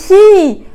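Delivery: shriek. Retold or imitated. Reliving her panicked scream.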